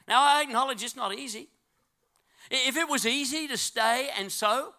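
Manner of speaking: high, loud preacher exhorting the crowd; full-force preaching. Real, not acted.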